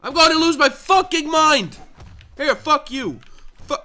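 very loud, high furious scream; raging scream. Real, not acted. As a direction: lose it completely. Losing, raging.

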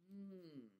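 usual speaking voice

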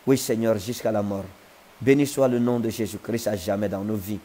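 normal talking voice

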